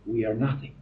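low, soft, reverent prayer with pauses. Real, not acted. Preacher asking God to guide them tonight, hushed prayer. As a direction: pray softly, pausing between phrases.

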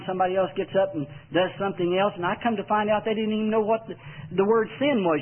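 normal talking voice

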